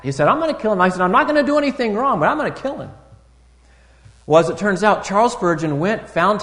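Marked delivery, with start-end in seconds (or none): 0.3-0.8 higher, louder mock threat; a young boy insisting to his granddad, mock angry; hammed-up boyish vow. 1.0-2.9 higher, louder mock threat; a young boy insisting to his granddad, mock angry; hammed-up boyish vow.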